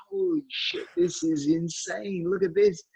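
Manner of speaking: high, slow amazement, stretched out; dazzled fan seeing his hero; awed. Retold or imitated. Retold awe at meeting George.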